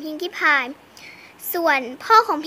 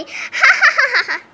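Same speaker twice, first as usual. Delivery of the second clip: mocking laugh; 6 semitones higher, 6 dB louder than usual. Taunting laugh after dare.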